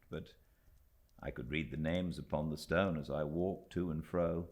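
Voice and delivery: plain reading voice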